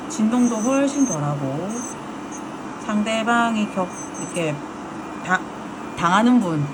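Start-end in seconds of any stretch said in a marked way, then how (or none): none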